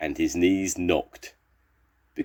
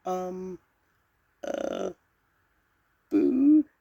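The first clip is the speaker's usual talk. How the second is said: tiniest squeak; 14 semitones higher, much slower than usual. A narrator's reading. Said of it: "in the tiniest squeak you ever heard".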